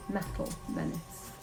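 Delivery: ordinary delivery, nothing marked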